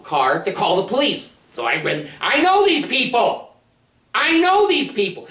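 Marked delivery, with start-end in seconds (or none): 2.2-5.3 much higher, louder, urgent repeat; urgent alarm. Retold or imitated. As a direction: sound the alarm to yourself. Worried thought about police.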